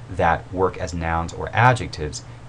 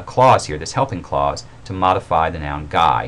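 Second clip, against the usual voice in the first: ordinary delivery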